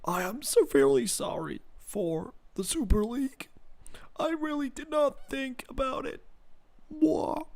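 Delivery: put-on American apology voice, drifting high and soft; John W. Henry. Streamer mocking an owner's apology video, fake American accent. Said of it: "My John W. Henry accent, right?".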